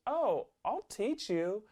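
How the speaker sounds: put-on high, soft, slow kid voice; sweet kid. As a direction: offer help as a sweet little kid. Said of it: "Sweetest kid in the world".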